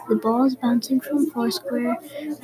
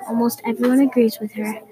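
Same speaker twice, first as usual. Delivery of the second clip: ordinary delivery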